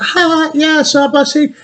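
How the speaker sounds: high-pitched imitation of clueless Canadians; high, held-out parody of a Canadian; mock Canadian. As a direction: put on a high mocking voice for Canadians. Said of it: "most Canadians are going".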